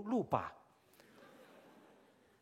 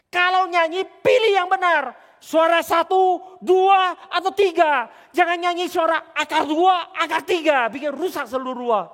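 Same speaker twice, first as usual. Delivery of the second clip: loud, high angry telling-off; mock-furious shouted scolding; 8 semitones higher, 12 dB louder, slower than usual. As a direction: yell at a singer who ruins the tune. Street musicians scolding his bad singing.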